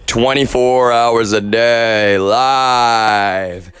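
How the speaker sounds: slow, drawn-out announcement; drawn-out live announcer call; stream announcer call. Real, not acted. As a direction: stretch it out like a slogan. Chants a stream slogan.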